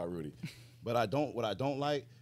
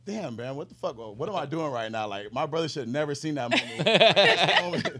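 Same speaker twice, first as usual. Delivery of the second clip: 1 laughing self-reproach; 6 semitones higher, 10 dB louder than usual. Retold or imitated. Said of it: "I was like".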